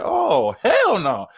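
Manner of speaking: retold hell-no, high and long; shocked refusal. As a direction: recoil at the news, stretching every word. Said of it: "And then he said".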